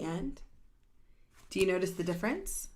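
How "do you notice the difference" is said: usual speaking voice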